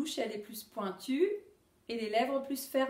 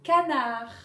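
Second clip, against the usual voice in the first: Canard called out loud; loud teaching word; 8 dB louder than usual. Shown as a demonstration. Teacher calling the word to pupils, loud. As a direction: call out Canard to the class. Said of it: "vous allez dire si on entend".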